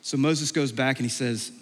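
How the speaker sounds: ordinary talk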